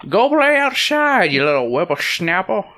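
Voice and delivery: cartoon granny ordering the kid out; grandmother. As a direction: shoo a child out in a high granny voice. Grandmother's lines in read story.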